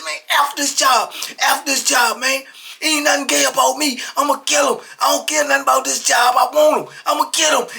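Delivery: high, loud, defiant outburst; heated imitation of threats; retold furious rant. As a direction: act out a man past caring, raging. Acted officer raging, threatening.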